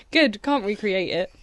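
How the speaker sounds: usual speaking voice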